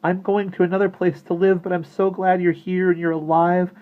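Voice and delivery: put-on high, loud, earnest girl's voice; emotional outburst. A narrator's reading. Maggie bids the living dolls goodbye, high overjoyed outburst. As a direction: pour it out overwhelmed and glad.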